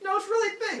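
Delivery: loud, high mock insistence; comic squabbling comeback; insistent. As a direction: double down on the lie. Acted argument over the bull.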